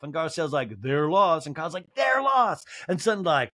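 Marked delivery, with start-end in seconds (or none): 1.9-2.7 high-pitched echoing impression; Kyle. Housewife echoing her friend's verdict, high put-on voice. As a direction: repeat it high and emphatic.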